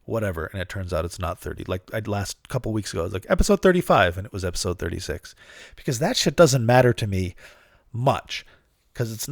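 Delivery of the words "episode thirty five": loud, high announcer call; mock confident announcement. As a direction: announce it boldly and wrong. Quoting his own wrong intro.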